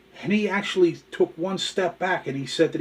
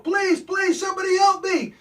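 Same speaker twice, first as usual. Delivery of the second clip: very high, loud comic panic; screaming his head off; 5 dB louder than usual. Acted. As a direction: shriek for help in hammed-up terror. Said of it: "The gorilla's still screaming his head off".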